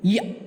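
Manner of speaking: a crisp single य sound; correct य. Shown as a demonstration. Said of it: "इसका उच्चारण ऐसे होता है". (य का सही उच्चारण, छोटा और साफ़)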